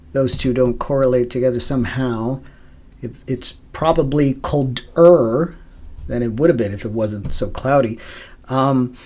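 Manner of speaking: usual speaking voice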